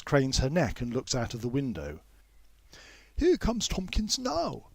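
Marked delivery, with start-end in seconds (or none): 3.2-4.7 higher, slower, mildly surprised; mild surprise. A narrator's reading. Miss Lomax sees Tompkins coming, mild surprise. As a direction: notice him through the window. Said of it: "she exclaims in mild surprise".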